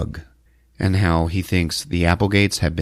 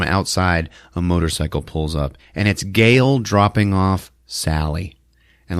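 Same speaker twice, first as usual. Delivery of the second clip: ordinary talk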